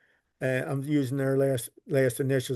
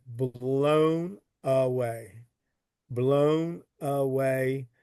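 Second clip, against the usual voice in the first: very slow, stretching out each blown away; drawn out in awe; much slower than usual. Real, not acted. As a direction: draw the words out, amazed by what you see. Awed by the archive boxes.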